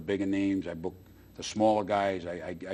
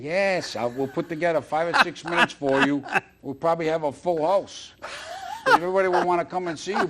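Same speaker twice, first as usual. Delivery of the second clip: higher and louder, bursting with enthusiasm; excited pitch; 8 semitones higher, 6 dB louder than usual. Real, not acted. Host eagerly urging his friend onto stage, excited. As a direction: sell him on the idea, all fired up. Pitching Bob doing comedy.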